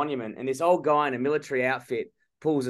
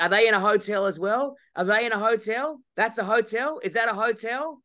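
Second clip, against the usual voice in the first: higher, teasing fake-innocent questions; mock innocent questions; 8 semitones higher than usual. Telling Nick how he baited the bike scammer, mock innocent and higher. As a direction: play dumb, poking at his lie.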